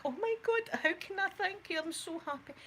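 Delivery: high, gushing imitated relief; relieved gush. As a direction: squeal your thanks like he just saved you. Thanking rescuer after reversing off cliff.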